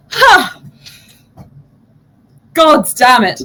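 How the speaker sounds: a high sharp "Ha!" then a loud curse; startled outburst. Real, not acted. GM caught off guard, loud yelp and curse.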